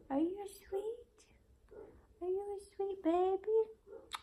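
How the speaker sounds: high, slow whispered coo. Real, not acted. Mom coos to newborn baby.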